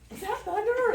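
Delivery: high, loud startled yelp of a question. Retold or imitated. Coworker on a walk hearing thunder, startled high question. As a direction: jump at the sudden thunder.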